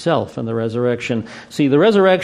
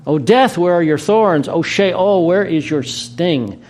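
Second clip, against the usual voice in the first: triumphant proclamation; 5 semitones higher, slower than usual. Acted. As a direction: proclaim it like a victory cry. Triumphant scripture climax.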